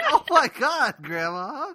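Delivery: high-pitched comic protest; mock-appalled grandson. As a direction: retell the horror in a high, exasperated cry.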